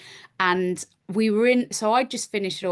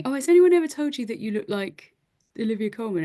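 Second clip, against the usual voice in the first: lightly voiced eager fan, higher; gushing fan asking a question, higher; mock fan voice; 4 semitones higher, faster than usual. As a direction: strangers stopping her in the street, lightly mimicked fan. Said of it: "people coming up to me all the time going".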